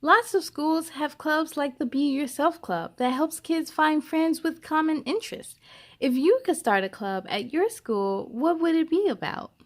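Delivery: gentle, expressive storybook reading; lively read-aloud for young listeners; reading to children. Acted. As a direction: read it like a picture book to a class. Reads a picture book aloud.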